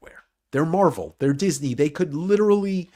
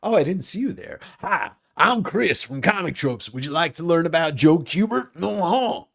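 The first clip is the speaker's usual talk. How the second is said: hammed-up Southern host greeting, pitched up; louder, drawling cartoon presenter voice; bouncy impression with swooping pitch; Chris; 6 semitones higher, 5 dB louder than usual. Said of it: "Here's my imitation of Chris".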